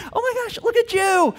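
very high, gushing amazement; delighted praise. Acted. Dad cheering daughter obeying while fighting, gushing praise. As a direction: burst out with proud surprise.

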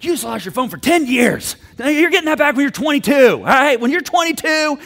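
loud, high ranting punishment; angry overreaction. Acted. Previous Kurt punishing daughter for ten years, furious. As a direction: lay down the sentence in hammed-up fury. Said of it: "out of anger".

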